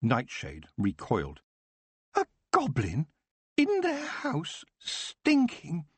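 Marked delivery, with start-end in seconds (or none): 2.1-5.9 high, slow, disdainful elf voice; an elf recoiling with disgust; distaste. Acted. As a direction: sneer at the very idea. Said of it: "she said with distaste".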